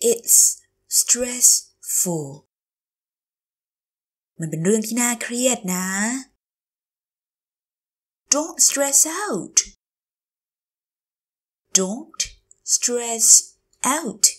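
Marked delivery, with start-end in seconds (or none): 0.1-2.3 It's, pause, then "stress-ful" in parts; slow teacher model of "It's stressful"; slow, broken up. Shown as a demonstration. Teacher repeats phrase slowly. 11.8-14.2 Don't, stress, out, each separate; slow teacher model of "Don't stress out"; word by word. Shown as a demonstration. Teacher repeats phrase word by word.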